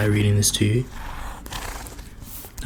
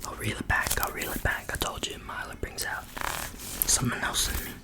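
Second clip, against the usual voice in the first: soft unvoiced ASMR whisper; hushed ASMR murmur; 8 semitones lower, 11 dB quieter than usual. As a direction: breathe it close to the mic.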